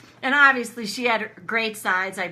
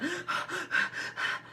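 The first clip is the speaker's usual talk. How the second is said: waking gasps; 10 semitones higher, 6 dB quieter than usual. Shown as a demonstration. Teller shows how she woke from a nightmare, gasping. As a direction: panicky gasping for breath, imitated. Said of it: "I remember waking up ... like that".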